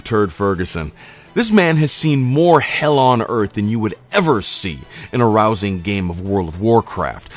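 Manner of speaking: usual speaking voice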